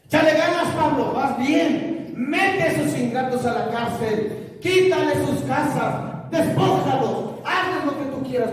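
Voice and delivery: high, loud egging-on; cheering supporters. Acted. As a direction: urge him on in drawn-out shouts. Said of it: "escuchaba la voz de la, de los judíos".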